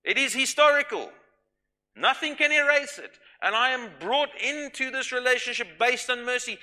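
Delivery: loud, confident declaration, voice up high; bold and joyful. Pretend. Believer boldly declaring confidence in God's mercy, loud and joyful. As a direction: proclaim it boldly to the Lord. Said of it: "with joy and abandon and boldness, go".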